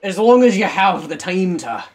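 slow, loud put-on Scottish; drawn-out mock Scottish rant; Scottish accent. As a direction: ramble emphatically in a Scottish accent. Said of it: "It's the Scottish hole now".

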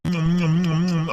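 low "mm" chewing sounds; imitated eating, low hums; munching noises. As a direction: chomp appreciatively on the food. Son eating his mother's food.

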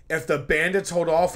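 ordinary talk, nothing marked

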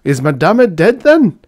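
loud question soaring very high at the end; bold student's cry. A narrator's reading. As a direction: call out the cheeky question. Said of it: "cried a student, bolder than the others".

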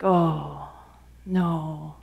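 low, soft, sinking "oh, no"; dismayed Moses. Acted. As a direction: Moses to God, shrinking from the task, dismayed. Moses dreads the task.

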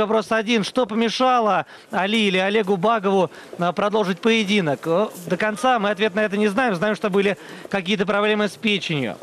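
usual speaking voice

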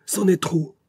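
high, sharp outraged protest; indignant cry. A narrator's reading. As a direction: son bursting out at father's insult, indignant. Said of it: "dit-il".

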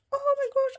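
high little squeal of surprise; delighted squeal. Real, not acted. Finds another cute freebie.

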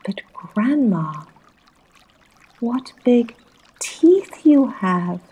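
high, loud storybook alarm; alarmed cry. A narrator's reading. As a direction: cry out, stretching the last words.

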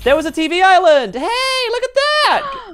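loud, high, thrilled reveal; amazed exclamation. Real, not acted. Player seeing the secret island appear, amazed high shout. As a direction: squeal with amazement.

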